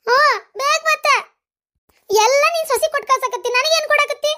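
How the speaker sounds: very high-pitched whining complaint; petulant demand. Acted. Younger sister demanding gold from elder, petulant. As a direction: whine and insist on your share.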